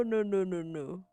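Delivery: ordinary talk, nothing marked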